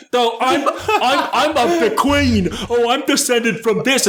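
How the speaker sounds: high, hammed-up British bumpkin voice; mock a British royalist in a broad, silly accent. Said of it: "piece of shit British people are like".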